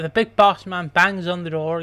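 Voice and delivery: ordinary delivery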